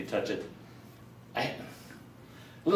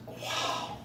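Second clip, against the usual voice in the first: awed whisper; 12 dB quieter than usual. Retold or imitated. Lady in awe of snake staff.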